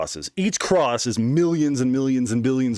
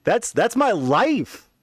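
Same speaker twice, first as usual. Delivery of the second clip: pitched-up protest, voice climbing; high protest; 5 semitones higher than usual. Retold or imitated. Refusing the vision's order, rising high protest. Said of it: "I'm like".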